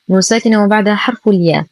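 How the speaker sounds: ordinary talk, nothing marked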